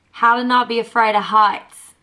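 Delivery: "heights" mock Aussie, "afraid" as "afroid"; badly faked Aussie accent for Siri; bad Australian accent. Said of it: "It's the worst Australian accent".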